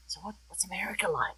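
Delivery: whispered, curious question; eager whisper. Retold or imitated. Friends back home asking about America, whispering. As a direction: ask in an unvoiced, conspiratorial whisper. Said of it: "everyone was like".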